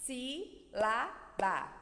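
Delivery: SÍ, LA, BA with gaps between; Sílaba very slowly, one syllable at a time; syllable separation. Shown as a demonstration. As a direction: chop Sílaba into three separate beats. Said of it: "A separação silábica".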